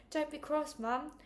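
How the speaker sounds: lightly voiced girl begging her mother. A narrator's reading. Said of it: "I begged".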